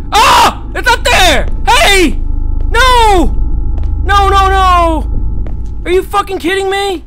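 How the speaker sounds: high, loud frantic shouts; panicked yelling. Real, not acted. Chased, searching frantically.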